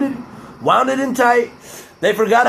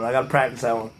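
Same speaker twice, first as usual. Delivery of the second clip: ordinary talk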